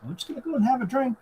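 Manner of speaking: tossing off the invite, quick and high; upbeat quick David. Retold or imitated. Recapping David breezily inviting Luke in, quick and high.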